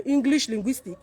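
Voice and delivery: usual speaking voice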